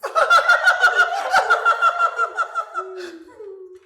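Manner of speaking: long shrieking cackle, very high and loud; wild high cackle; maniacal high laugh. Acted. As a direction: laugh like a madman. Maniacal laugh after the line.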